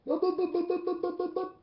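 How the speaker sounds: high fast mock chatter of a babbling man; blabbermouth imitation. Mocking the high-ranking man's endless talk with high chatter. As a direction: rattle off bup bup like someone who won't stop talking. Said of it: "came with a blabbermouth".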